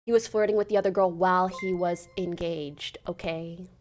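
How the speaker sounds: ordinary talk, nothing marked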